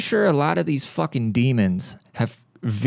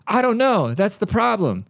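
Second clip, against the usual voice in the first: put-on much higher, indignant old lady voice; mock Karen; 10 semitones higher than usual. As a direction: whine it like a clueless entitled customer. Karen voiced in retail story.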